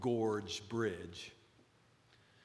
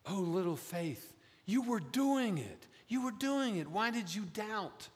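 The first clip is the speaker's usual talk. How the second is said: much higher, tender, encouraging Oh, little faith; gentle encouraging tone; 10 semitones higher than usual. Retold or imitated. Jesus to Peter, gently encouraging, high. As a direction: coax him lovingly, proud of him. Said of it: "maybe Jesus' tone was more like this".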